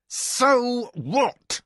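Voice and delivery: high, loud, fed-up retort; exasperated. A narrator's reading. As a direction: toss it off in exasperation. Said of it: "Kevin said in exasperation".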